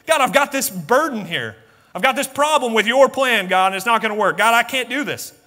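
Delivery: loud, high-pitched excuses to God; protesting Moses. Acted. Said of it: "Moses told God no".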